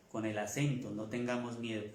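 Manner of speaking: usual speaking voice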